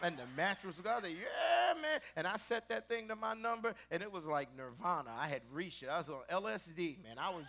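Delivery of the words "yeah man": delight. Retold or imitated. Ecstatic on the mattress.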